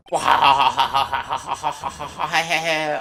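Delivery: long loud maniacal laugh; villain cackle. Acted. The exposed police chief bursting into a mad laugh.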